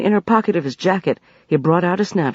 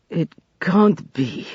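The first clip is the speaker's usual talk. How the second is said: usual speaking voice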